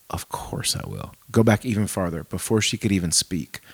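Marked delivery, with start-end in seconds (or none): 0.1-1.1 low, slow whispered comfort; tender whisper. Acted. Dad picking up his crying toddler, slow tender whisper. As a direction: whisper gently to a hurt child.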